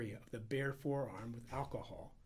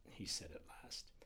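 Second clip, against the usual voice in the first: ordinary delivery, nothing marked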